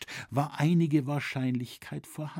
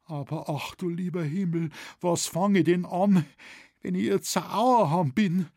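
higher, mildly wailing voice; plaintive, pitched-up dialect worry; dismayed lament; 6 semitones higher than usual. A narrator's reading. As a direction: lament your fate with a touch of panic. Voiced shopkeeper laments his enchantment.

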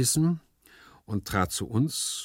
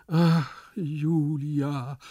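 higher, very drawn-out sigh; lovesick sigh; 7 semitones higher, 4 dB louder, much slower than usual. A narrator's reading. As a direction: sigh slowly for your beloved. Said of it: "seufzte Gumpellino". (schmachtend geseufzt, lang gedehnt)

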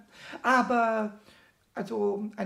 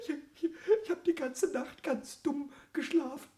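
whimpering, half-sobbing complaint; tearful. Acted. Pumuckl lamenting his bed to Eder, tearfully. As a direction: sob out the lost little bed.

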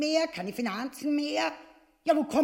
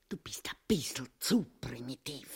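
whispered rebuke; 12 semitones lower, 7 dB quieter than usual. Acted. Wife scolds him aside.

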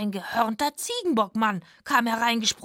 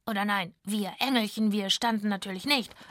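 ordinary delivery

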